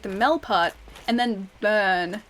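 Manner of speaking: "the Mel part, and then burn" spelled out slowly, syllable by syllable; slow teaching demo, "burn" drawn out like the word burn; Melbourne taught slowly. Shown as a demonstration. Teaching US friends to say Melbourne, slow careful syllables. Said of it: "when they've asked me how to say Melbourne".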